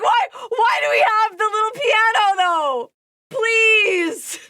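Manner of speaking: high, loud, pleading through laughter; laughing plea. Real, not acted. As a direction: beg for an answer while cracking up. Laughing, mock pleading outburst.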